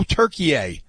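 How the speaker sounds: Turkey A as TUR-kee-AY; renamed country pronunciation. Shown as a demonstration. Co-host recalling the country's new name, Turkey A as "Türkiye". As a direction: say Turkey A with an added "ay" ending. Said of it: "what country added an A".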